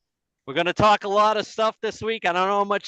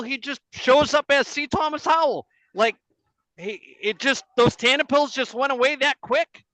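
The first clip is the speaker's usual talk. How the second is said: normal talking voice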